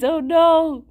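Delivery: sudden loud high "Oh no!"; shocked outcry. Real, not acted. Streamer to chat, picturing worm-eyed Alex, a loud shocked cry. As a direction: yelp it in mock horror.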